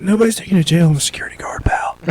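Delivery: put-on hushed whisper to the drunk; whispered reassurance. Guard calming a scared drunk, whispered. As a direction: whisper it gently to calm him. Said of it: "I'm like".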